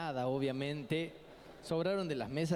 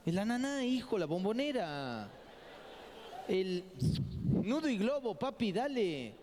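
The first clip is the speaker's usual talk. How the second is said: higher, pushy mock mom; mother listing slang; 4 semitones higher than usual. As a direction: rattle off the names like an impatient mom. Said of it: "Y me dice mi mamá". (la madre enumera apurada, voz más aguda)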